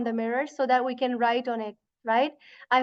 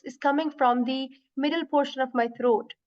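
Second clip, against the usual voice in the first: ordinary delivery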